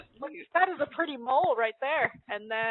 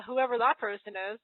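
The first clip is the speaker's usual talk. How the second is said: ordinary talk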